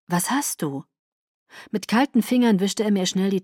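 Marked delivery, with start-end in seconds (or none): 0.1-0.8 mildly worried question; a touch of alarmed concern; full of worry. A narrator's reading. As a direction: ask with understated worry. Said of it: "lauter jetzt und voller Sorge".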